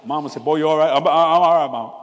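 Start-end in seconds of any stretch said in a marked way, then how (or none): 0.4-0.9 put-on loud call to her boy. Mama checking on her son coming home, loud call. 0.9-1.9 loud reply to mama; calling back. Retold or imitated. Son answering his mama from the hall, loud.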